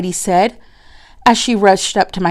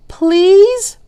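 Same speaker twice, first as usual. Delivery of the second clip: pleading; 11 semitones higher, 4 dB louder than usual. Acted. Children pleading.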